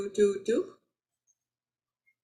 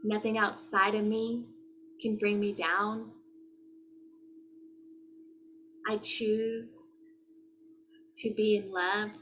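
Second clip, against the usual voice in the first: spoken affirmations; 5 dB quieter than usual. Acted. Closing the video, reciting soft affirmations to viewers.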